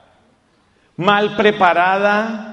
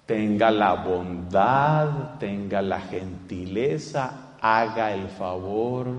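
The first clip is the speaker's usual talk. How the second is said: slow, low, hushed coaxing; soft pleading; 10 semitones lower, 8 dB quieter, slower than usual. Acted. Priest pleading softly with a brother to come in, slow hushed coaxing. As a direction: coax him in gently and slowly, almost murmuring.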